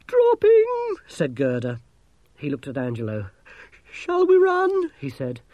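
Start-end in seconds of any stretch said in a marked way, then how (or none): 0.1-0.9 very high, loud cry; frightened wail. A narrator's reading. Frightened gnome sees ship falling. 3.9-4.9 very high, loud, frightened question; panicky squeal. A narrator's reading. Frightened gnome under falling ship.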